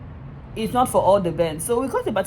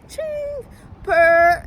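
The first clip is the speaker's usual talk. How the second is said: mock wail; 11 semitones higher, 5 dB louder than usual. Mock cry over the job.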